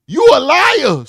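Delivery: high, loud accusation; mock accusing yell. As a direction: shout it like calling her a liar. Reading angry text aloud.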